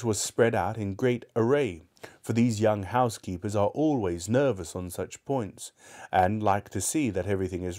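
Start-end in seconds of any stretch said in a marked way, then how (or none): none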